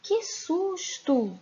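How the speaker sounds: high, slow cry of fright; exclaimed in fright. Shown as a demonstration. Teacher showing children a fright, high and slow. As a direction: read it as a real fright.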